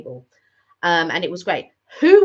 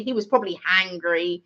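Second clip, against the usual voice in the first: ordinary delivery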